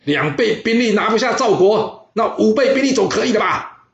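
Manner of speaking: raging royal shout; angry shout. Acted. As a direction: bellow it at the advisor like an insulted king. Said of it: "他非常生气的大声喊着说".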